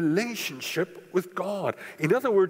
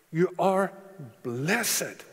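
"you are" firm, pause, then "blessed" set apart; weighty, spaced-out "you are ... blessed"; stressed statement; much slower than usual. Real, not acted. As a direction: land on "blessed" deliberately after a pause. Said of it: "And I stress that".

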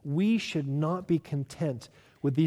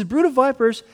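frustrated rebuke; 7 semitones higher, 6 dB louder than usual. Retold or imitated. Jesus rebuking the religious leaders, Brood of vipers rebuked loud and high. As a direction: Brood of vipers flung out higher and louder. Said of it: "He's frustrated because they're so self-righteous".

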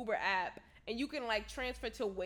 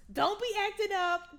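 loud, high scolding quote; raised-voice telling off; acted scolding; 8 semitones higher, 7 dB louder than usual. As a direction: playfully tell off your man, pitched up. Acted scolding on video call.

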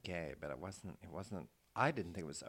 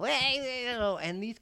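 very high, loud squeaky cartoon "Hey"; squirrely voice; 14 semitones higher, 10 dB louder, slower than usual. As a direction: pop it out like a tiny cartoon critter. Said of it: "little squirrely voices to do".

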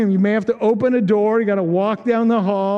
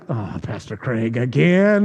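mock groan; 11 semitones lower, slower than usual. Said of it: "You're like".

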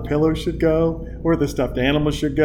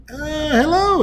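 much higher, a hesitant hello; awkward neighbour greeting; 13 semitones higher than usual. Retold or imitated. Owner of the daycare walks over, awkward high hello. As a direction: squeak out a timid hello.

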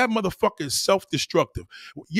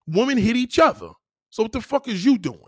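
usual speaking voice

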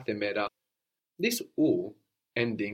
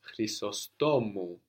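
Chrysostomu slowly, stress moved forward onto STO; careful teacher model of the name; slow stress demo; slower than usual. Shown as a demonstration. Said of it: "I mentioned Chrysostomos".

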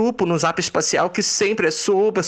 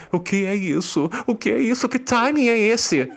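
normal talking voice

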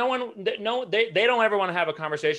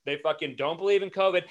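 usual speaking voice

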